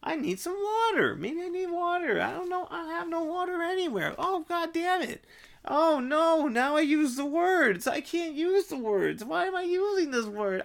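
pitched high and strained through a rant; high, worked-up rambling. Real, not acted. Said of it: "talking in such a high-pitched voice".

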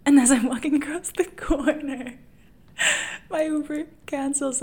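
giggling through the story; laughing while talking. Real, not acted. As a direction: tell it through real laughter. Laughing while retelling own breakdown.

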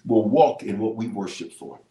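usual speaking voice

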